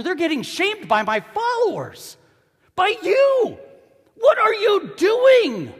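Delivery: loud, very high indignant shout; angry Jesus. Acted. Jesus confronts his followers over injustice, shouting angrily. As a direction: build to an outraged shout at followers. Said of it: "Jesus gets so angry".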